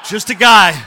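bursts out loud, still laughing; laughing exclamation. Real, not acted. To the congregation about the old Mustang, a loud laughing outburst. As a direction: blurt it out loud through a laugh.

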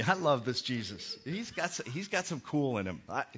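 normal talking voice

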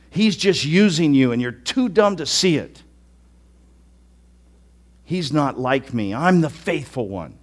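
ordinary talk

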